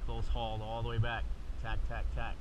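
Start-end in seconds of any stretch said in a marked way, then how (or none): none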